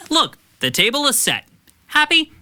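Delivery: high sarcastic jab. Acted. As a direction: throw it back at her sarcastically. Sarcastic teen to mom.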